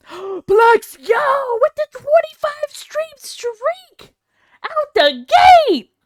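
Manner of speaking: high, loud, slow celebration; hype. Real, not acted. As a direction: announce it like a champion. Hype for a stream streak.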